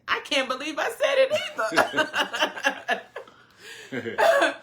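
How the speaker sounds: high, laughing self-quote; laughing retort. Retold or imitated. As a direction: break into laughter while quoting yourself. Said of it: "And I'm like".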